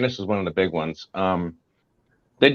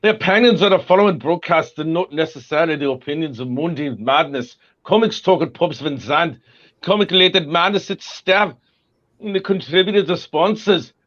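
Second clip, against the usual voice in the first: hammed-up British announcer read, pitched higher; a put-on posh British disclaimer voice; playing a British TV announcer; mock British accent; 7 semitones higher than usual. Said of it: "read the disclaimer just to show him your British accent".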